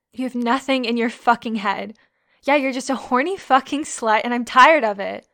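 furious at the guy who ignored her instructions; furious shouting. Acted. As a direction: shout him down in fury. Furious outburst at him.

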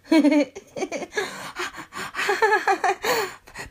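high, helpless burst of laughter; tickled laughter. Acted. Tickled by a best friend, helpless laughter. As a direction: laugh long and high, losing breath.

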